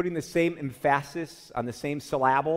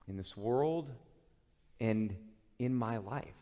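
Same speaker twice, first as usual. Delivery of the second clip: hushed prayer; 6 semitones lower, 5 dB quieter than usual. Acted. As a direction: pray it quietly, drawing each phrase out. Quiet prayer voiced at close.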